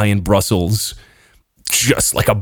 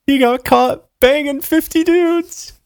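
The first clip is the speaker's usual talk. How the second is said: mocking scorn. Real, not acted. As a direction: sneer it with amused contempt. Mocking the politician.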